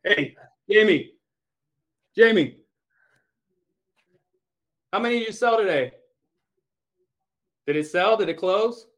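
high, loud call across the room; eager call to a coworker across the office. Real, not acted. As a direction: shout the name to get attention. Calling across the office to Jamie.

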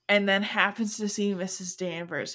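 ordinary talk

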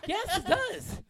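high, loud comeback with laughter; laughing insistence. Real, not acted. Friend insists the sausage brand matters, laughing insistence. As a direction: insist loudly, half laughing.

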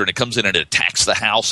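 ordinary talk

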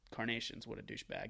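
soft low aside under the breath; a touch of a snide mutter; muttered insult. Retold or imitated. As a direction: mutter it so only you hear.

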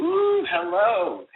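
drawn-out, high impressed ooh; slow, swooning hello. Acted. Reacting to guest's job title.

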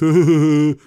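loud, high chuckling huh-huh-huh; imitated goofy laugh. Showing the kid on the video, loud mock laugh.

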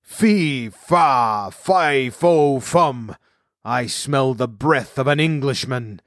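booming storybook giant chant, drawn out; giant's thundering cry, each opening word set apart; thunderous giant. A narrator's reading. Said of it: "a voice like thunder cried out".

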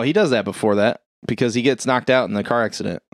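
normal talking voice

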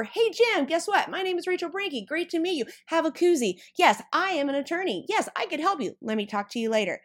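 high, eager rush of pitching chatter; bouncing from person to person, too eager; hyper networker. Retold or imitated. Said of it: "Then you're running over".

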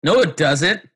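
a hint of an indignant mother; stubborn mom. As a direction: insist like a mom who won't be told. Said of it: "Uh, Mom".

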